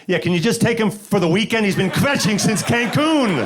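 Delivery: put-on a casual mom handing off her kid at the border. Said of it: "they would have been like".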